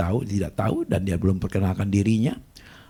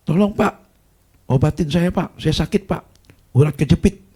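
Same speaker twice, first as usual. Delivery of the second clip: pleading sick man; 8 semitones higher, 5 dB louder than usual. Retold or imitated. Sick man begs for healing.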